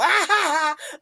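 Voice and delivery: high embarrassed giggle; nervous laugh. Real, not acted. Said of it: "that's my nervous laugh".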